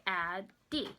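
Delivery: "Addie" said slowly in two split beats; deliberate syllable-by-syllable "Addie"; sounded out by syllable. Shown as a demonstration. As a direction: stretch "Addie" into Ad, pause, dee. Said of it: "sound out where the syllables are".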